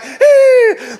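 sudden high loud yelp as if clawed; high yelp of pain. Retold or imitated. Husband yelping when wife claws him in labour. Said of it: "and I'm like".

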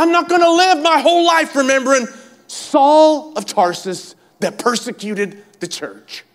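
high-pitched preacher voicing an apostle's confession; impassioned preaching. Apostle refusing to live in his past, impassioned preaching. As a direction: declare it forcefully, high and loud.

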